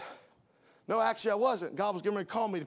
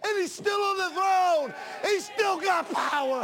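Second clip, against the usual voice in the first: loud, rising preacher's cadence; preaching at full force; 10 semitones higher, 4 dB louder than usual. Acted. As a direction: preach it out to the congregation.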